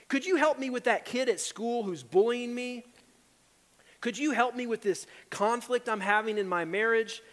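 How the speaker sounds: pleading request. Shown as a demonstration. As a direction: beg for help on the coworker.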